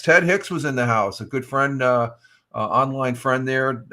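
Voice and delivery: usual speaking voice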